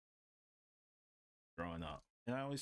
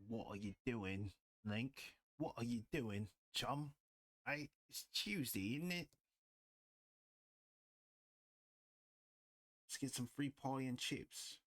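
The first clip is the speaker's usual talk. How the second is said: playful put-on British accent, pitch climbing higher; mock British accent; 4 semitones higher than usual. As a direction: tease in a jokey British accent. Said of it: "I genuinely love British accents".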